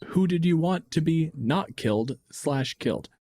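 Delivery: ordinary talk, nothing marked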